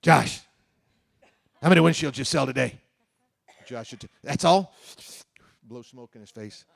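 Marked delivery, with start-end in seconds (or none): 0.0-2.8 loud, in-your-face boss right up close; pushy close-talking boss. Retold or imitated. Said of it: "talk like this". 4.2-4.7 loud, higher, scornful "That's all?"; scoffing boss. Retold or imitated. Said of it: "talk like this".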